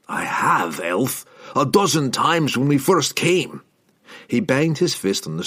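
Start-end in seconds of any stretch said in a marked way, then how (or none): 0.1-3.6 dwarf. A narrator's reading. Dwarf answers Drizzt.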